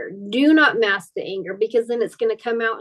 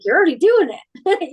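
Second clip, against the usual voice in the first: raised, louder coach quote; coach's voice; 7 semitones higher, 6 dB louder than usual. Retold or imitated. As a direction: repeat it higher and louder, like the coach insisting. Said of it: "He's like".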